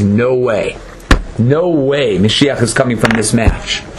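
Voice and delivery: low-pitched, offhand dismissal; dismissive disbelief. Retold or imitated. Satan looking at an unlikely match, dismissive and low. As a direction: wave it away in a low voice. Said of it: "looks at it and says".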